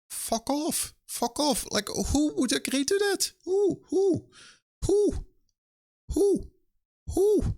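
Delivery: a ranting developer squeals at publishers' contract terms, in squeaky outraged disbelief. Real, not acted. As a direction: shriek it in disbelief like nobody sane would sign. Outraged rant at unfair contract terms.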